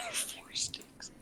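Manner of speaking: whispered through a giggle; laughing whisper. Real, not acted. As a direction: giggle it under your breath.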